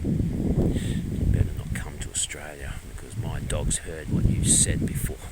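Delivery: slow, low, playful menace; mock ominous warning. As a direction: lean in and threaten slowly, half joking. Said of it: "I better warn you".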